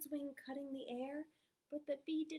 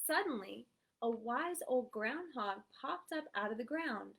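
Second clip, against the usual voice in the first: plain reading voice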